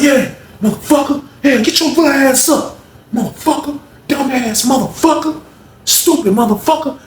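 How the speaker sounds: high, gloating cussing; retold taunting yell. Honey bun man taunting his beaten roommate, high gloating yell. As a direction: taunt him as he goes down.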